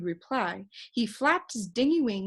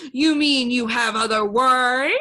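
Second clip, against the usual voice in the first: loud, gleeful bird cry; bright excited squawk of a question; happy cry; 4 semitones higher, 11 dB louder than usual. A narrator's reading. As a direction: shout it out, delighted. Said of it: "cried the bird happily".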